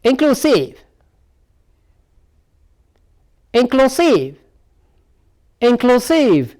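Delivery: "Inclusive" held high, then falling on the last syllable; stress demonstration. Shown as a demonstration. Teacher models the next word for practice, high-then-falling stress drill on "Inclusive". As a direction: draw out "Inclusive" on a high pitch, dropping at the end. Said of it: "The stress lies on here now".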